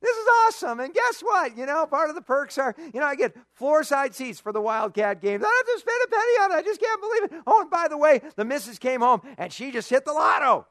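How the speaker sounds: high, loud bragging neighbor; gloating neighbor. Retold or imitated. Lucky neighbor boasting over the fence, high and loud. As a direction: crow about your good luck. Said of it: "He goes".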